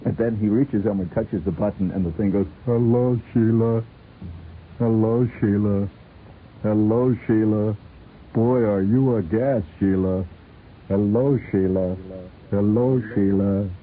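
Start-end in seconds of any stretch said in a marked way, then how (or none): 2.7-13.7 lightly voiced talking doll. The talking doll repeating greeting.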